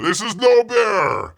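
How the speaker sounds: loud, slow hammed-up yell; mock shout. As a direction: bellow the refusal. Bear refusing, shouted.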